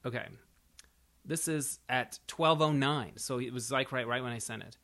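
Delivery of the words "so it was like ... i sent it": ordinary talk, nothing marked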